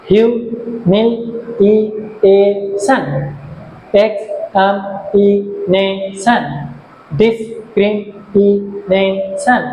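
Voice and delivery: slow list, every word cut into five even beats; syllable by syllable. Shown as a demonstration. Teacher showing five-syllable words, each slowly divided into syllables. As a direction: pause briefly between the syllables of each word. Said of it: "হয়েছে পাঁচটা সিলেব".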